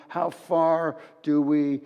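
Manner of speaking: ordinary talk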